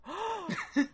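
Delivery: high theatrical gasp; mock gasp. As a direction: gasp in hammed-up shock. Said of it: "you're supposed to be like".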